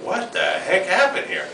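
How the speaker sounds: stunned at the gloom; baffled. Retold or imitated. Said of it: "I'm like".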